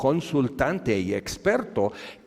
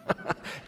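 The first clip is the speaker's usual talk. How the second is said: mocking laugh; 6 dB quieter than usual.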